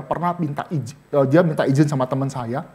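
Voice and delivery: ordinary talk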